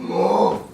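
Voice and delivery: long, low angry roar; angry growl. Shown as a demonstration. Teacher acting out anger for children, angry growl. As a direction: growl it out from deep down. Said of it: "I feel angry".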